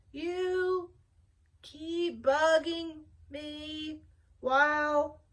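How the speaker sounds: very slow, drawn-out sloth voice; slow sloth yell. Acted. Sloth complains to Flytrap, very slow. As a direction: stretch every word like a sloth. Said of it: "the sloth yelled".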